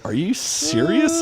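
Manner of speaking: can't believe the teacher means it; incredulous question. Retold or imitated. Student asking the teacher if the assignment is real, high disbelief.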